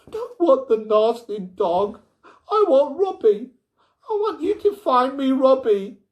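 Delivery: loud, high tearful wailing; a sobbing girl demanding her friend; crying child. A narrator's reading. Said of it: "she spluttered into a shrill wail".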